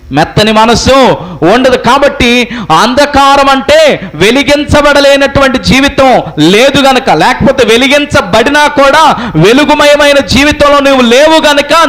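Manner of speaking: high, sustained sermon cry; preaching at full force. Real, not acted. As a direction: declaim it to the congregation in long high-pitched phrases. (గట్టి స్వరంతో ప్రసంగం)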